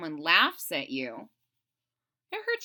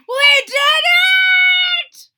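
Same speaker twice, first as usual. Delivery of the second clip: high, much louder triumphant shout; excited cry; 17 dB louder than usual. Acted. Toad cheering when the kite finally flies, loud joyful cry. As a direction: burst out in victory. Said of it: "cried Toad".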